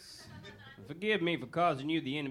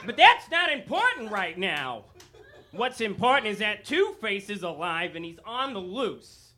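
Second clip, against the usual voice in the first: abrupt loud switch; 4 semitones higher, 6 dB louder than usual. Acted.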